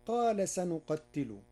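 ordinary talk, nothing marked

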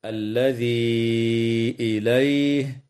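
الذي إليه with the vowel held four or five counts; separated madd. Shown as a demonstration. Teacher shows students the long madd in الذي إليه. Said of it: "مد منفصل".

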